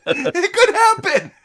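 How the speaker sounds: high, slow squeal through laughter; laughing insistence. Real, not acted. Host defends his silly casting joke, laughing. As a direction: insist while cracking up.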